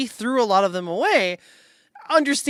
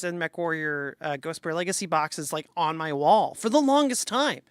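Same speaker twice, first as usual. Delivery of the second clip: ordinary delivery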